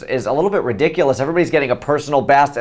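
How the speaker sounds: normal talking voice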